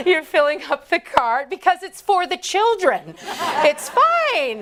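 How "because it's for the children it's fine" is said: high, loud, over-insistent excuse; self-justifying. Acted. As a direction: protest innocence, pitched up and emphatic. Justifying her candy buying to herself.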